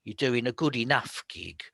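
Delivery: ordinary talk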